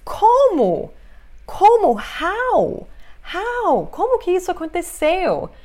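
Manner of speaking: high, loud exclaimed questions with swooping pitch; expressive questioning. Shown as a demonstration. Teacher demonstrating "how" physically for students, exaggerated asking. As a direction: act out astonished asking, exaggerated. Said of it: "expressar, isso é uma coisa física".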